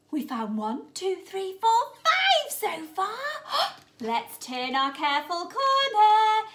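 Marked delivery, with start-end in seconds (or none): none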